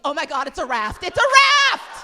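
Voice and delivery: building to a high, loud, stretched shout; mock alarm. Swimmer finding the pad swells up, mock alarm. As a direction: escalate into a comic panicked scream.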